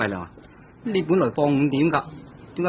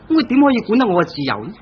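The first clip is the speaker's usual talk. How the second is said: rising, louder indignant question; indignant outburst; 8 semitones higher, 4 dB louder, faster than usual. Acted. Husband venting to his cousin about his wife, indignant. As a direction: push back hard against her control.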